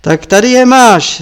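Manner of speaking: furious master yelling while striking; angry shout. Acted. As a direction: bark it as the fist lands. Marpa strikes the monk.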